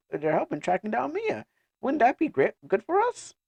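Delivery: high-pitched, eager persuader; coaxing companion. Acted. Companion coaxing Ayer to meet clan, high and eager. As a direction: pitch it high, talking them into it.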